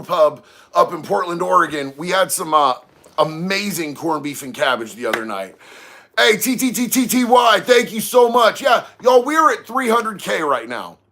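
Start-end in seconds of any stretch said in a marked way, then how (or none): 6.2-10.4 loud, raised, hyped thank-you; high-energy livestream thanks; excited shout-out. Real, not acted. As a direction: call it out high and loud to a supporter. Thanks a viewer in live shout-out.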